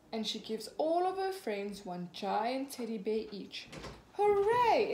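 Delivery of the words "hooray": loud high cheer; big happy hooray. A narrator's reading. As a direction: cheer like a delighted little pig. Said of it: "Everyone cheers".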